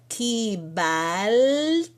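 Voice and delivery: "kibalti" very slow, long rising "bal", separate "ti"; drawn-out syllables. Shown as a demonstration. Teacher building the verb form, drawn-out syllables. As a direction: stretch and raise "bal" in "kibalti", then drop "ti". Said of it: "e vai ficar kiba".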